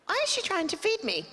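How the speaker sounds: baffled. Retold or imitated. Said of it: "I'm like".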